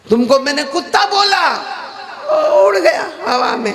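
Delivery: high, ringing rhetorical comeback; acted cleric driving home his point; pointed retort. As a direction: throw the argument back, pitch climbing high. Maulana argues back at angry hakim.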